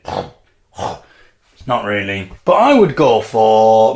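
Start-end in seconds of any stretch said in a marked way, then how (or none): 0.0-2.3 inhaled pig snort. Shown as a demonstration. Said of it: "You can't really do it exhaling".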